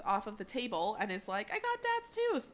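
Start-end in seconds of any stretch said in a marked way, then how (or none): 1.5-2.4 put-on very high, sing-out kid voice; high mock-childish boast; gloating child. Child character gloats over tooth.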